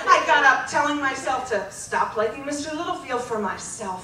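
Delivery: ordinary talk, nothing marked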